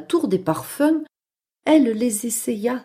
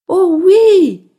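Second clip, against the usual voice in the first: high, loud mouse squeal; eager little mouse; 8 semitones higher, 9 dB louder than usual. Acted. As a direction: jump at the offer as a mouse. Mouse eagerly accepts lesson. (petite souris enthousiaste, voix aiguë)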